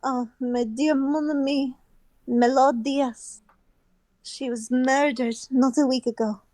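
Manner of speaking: put-on deliberate, halting French accent. Opera house head telling of Melodias's tragedy, French accent. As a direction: break the news in a poor French accent. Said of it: "poor French accent".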